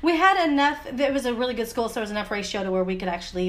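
ordinary talk, nothing marked

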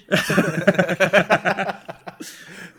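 high, loud burst of laughter. Real, not acted. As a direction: crack up loudly. Big laugh.

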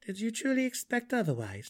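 high-pitched, lightly amused question; lightly voiced amused dark elf; amused Drizzt. A narrator's reading. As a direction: ask it with a touch of mirth. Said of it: "Drizzt stopped with a heartfelt laugh".

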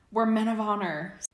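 high, loud booming declaration. Acted. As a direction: boom it like a knight. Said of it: "Sirius boomed".

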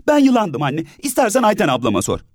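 put-on high child voice begging mother; pleading child. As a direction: tug at mom and insist. Tolga clinging to mother.

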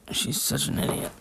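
soft unvoiced whisper; whispered aside. Real, not acted. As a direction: whisper it under your breath. Aside about dog, whispered.

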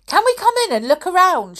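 put-on high, quick, loud boy's shout. A narrator's reading. Colin shouting a request to the caretaker, shouted. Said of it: "shouted Colin".

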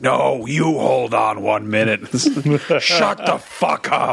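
slow, drawn-out Death voice cutting them off; hammed-up Grim Reaper rebuke; Death from a comedy sketch. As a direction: stretch each word as an imperious Death. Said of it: "Death shows up and he's like".

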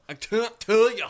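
loud, high, slow Irish brogue; mock Irish accent. Co-host dodging the question, mock Irish accent. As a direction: belt it out in a put-on Irish lilt.

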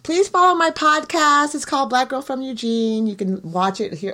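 upbeat sign-off plug; podcast outro plug. Acted. Host asks listeners to follow and donate, as an upbeat outro. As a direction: sell the podcast warmly.

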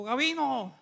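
lightly mimicked visiting preacher calling out; imitating Puerto Rican pastor. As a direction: call the name like that preacher. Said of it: "vamos a tratar de asimilarlo".

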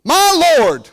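fervent pastor driving it home; full-force preaching. Acted. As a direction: preach it up to the rafters.

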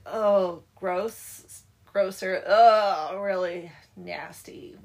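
loud, drawn-out "oh" of revulsion; disgusted reaction. Retold or imitated.

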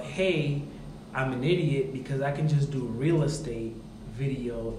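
normal talking voice

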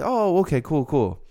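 high, light oh okay, cool cool; imitating a breezy reply. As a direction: toss it off like a polite stranger. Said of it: "So like".

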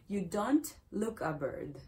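ordinary talk

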